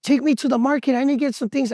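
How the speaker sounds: high-pitched imitation of a demanding grandma; grandma calling out. Grandma asking grandson for a market ride, high imitated call. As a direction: put on grandma's high, insistent voice. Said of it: "She's like".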